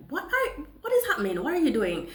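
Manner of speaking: high, panicked questions; high-pitched startled what-is-happening; alarmed. Retold or imitated. Alarmed at man behind her.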